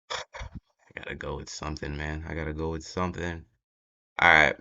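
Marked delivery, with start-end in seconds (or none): 0.1-3.4 low, soft self-talk while deciding; quiet mutter, making up his mind; muttering to himself. Real, not acted. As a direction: talk it over under your breath, quicker.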